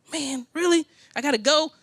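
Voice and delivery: high, deflated whine of surprise; dismayed disbelief. Retold or imitated. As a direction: throw it up high, reluctant and stunned. Pastor also says go.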